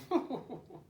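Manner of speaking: 1 short scoffing laugh. Acted. Owl laughs.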